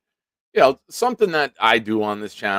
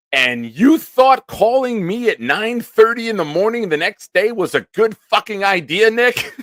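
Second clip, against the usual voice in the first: high, loud hammed-up scolding; an announcer turning into a furious rant; mock outrage; 9 dB louder than usual. [name] berating Nick for the morning call, mock furious. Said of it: "complete professional radio voice and then into a big".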